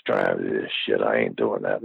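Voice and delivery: a hint of a low, sluggish friend; retold friend's voice. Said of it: "He's like".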